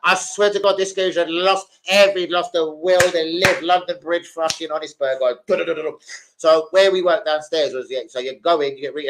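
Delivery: ordinary delivery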